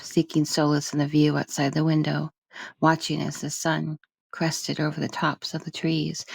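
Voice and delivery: usual speaking voice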